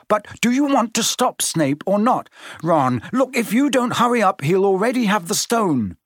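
snapped. A narrator's reading. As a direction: press them hard, time is running out. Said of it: "snapped Ron".

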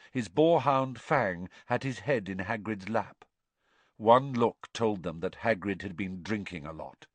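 ordinary delivery

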